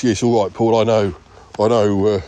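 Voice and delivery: ordinary talk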